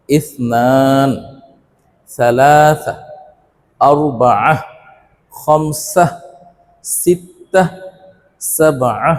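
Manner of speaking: slow Arabic counting drill with drawn-out long vowels; teacher's careful, stretched-out word-by-word recitation; repeat-after-me modelling. Shown as a demonstration. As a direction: model each number slowly, pausing for learners to echo. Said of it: "আমার সাথে সাথে বলবে".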